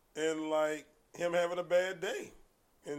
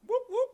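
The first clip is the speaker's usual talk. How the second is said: high, loud imitated police siren; mock siren call; siren whoop; 9 dB louder than usual. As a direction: yelp it like a squad car siren. Imitating police siren behind car.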